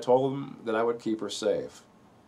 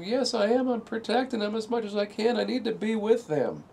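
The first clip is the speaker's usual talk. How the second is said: high insistent pleading voice, much higher than his own; imitated desperate wife; 13 semitones higher than usual. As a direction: insist tearfully she must reach them. Wife insisting on protecting kids.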